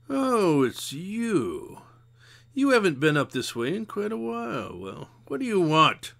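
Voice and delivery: a hint of a sour woman caught off guard; mildly startled then snippy; nasty Gert. A narrator's reading. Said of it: "Gert Bigger sounded so nasty".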